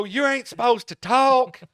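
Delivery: high, loud, slow mock retort; hammed-up scolding; Balaam's retort. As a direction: play it big and indignant. Balaam's reply voiced.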